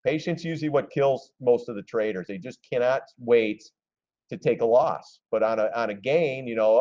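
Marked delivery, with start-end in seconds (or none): none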